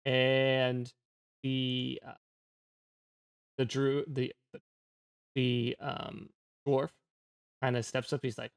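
ordinary delivery

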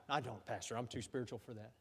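soft, low mock-innocent reply; mock pious denial. Congregant answers the pastor, mock pious and quiet. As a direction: murmur a put-on humble denial.

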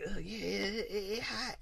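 very soft, breathless murmur; mock overheated swoon. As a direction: fade out as if overcome by heat. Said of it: "they get sweating on the other side".

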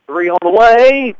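rising shout as the shot flies; excited call. Real, not acted. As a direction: call the three loud and slow. Shot in the air called out.

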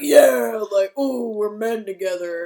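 loud, hammed-up hug cheer; big mock bro voice; mimicked manly bonding. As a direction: whoop it up, mocking awkward men hugging. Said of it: "I even mimicked it".

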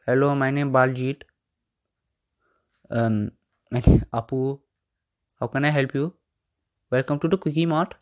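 put-on Indian shopkeeper accent, halting; hammed-up Indian accent greeting a customer; mock Indian accent. Host mocking accent gimmicks as a shopkeeper, mock Indian accent. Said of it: "You don't see me doing that".

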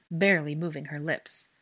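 ordinary delivery

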